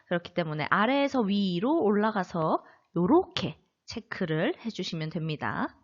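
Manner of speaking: usual speaking voice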